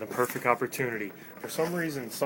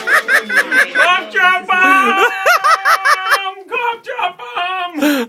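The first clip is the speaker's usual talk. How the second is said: laughing while shouting very high; yelling; 18 dB louder, much slower than usual. Real, not acted. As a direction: howl it out slowly, cracking up.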